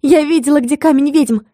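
high-pitched happy excitement; joyful announcement. A narrator's reading. Heroine telling companions she saw the witches' stone, delighted. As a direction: blurt out the good news gladly. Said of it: "сказала радостно".

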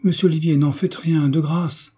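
urgent, high-voiced entreaty; lively protest. A narrator's reading. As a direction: jump in to stop him, imploring. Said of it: "dit vivement Ernestine".

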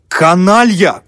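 high, loud outraged cry; angry exclamation. A narrator's reading. Dal realising he was tricked, exclaiming in anger. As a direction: burst out with it in anger. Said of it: "воскликнул в сердцах Даль".

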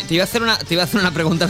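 normal talking voice

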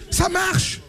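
ordinary talk

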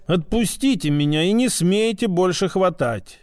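ordinary delivery, nothing marked

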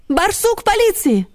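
high, drawn-out calling; high little dog hailing someone; barked call. A narrator's reading. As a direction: call down into a dark burrow. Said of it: "гавкнула Ксюня в тёмное отверстие".

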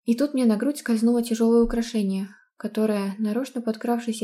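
plain reading voice

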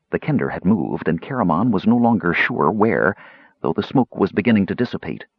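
usual speaking voice

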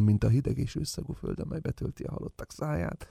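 fast, soft unvoiced whisper; eerie whisper. Dead father whispering of the grave, fast and soft. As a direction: creep it out of the grave.

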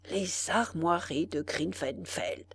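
breathless whisper over the crest; softly reverent whisper; hushed awe. Acted. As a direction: whisper it as if afraid to be heard. Concierge whispers over his find.

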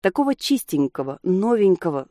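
ordinary delivery

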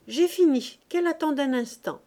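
acted put-off from a busy woman; busy call back. A narrator's reading. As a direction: call out without stopping your work. Busy aunt calls back while working.

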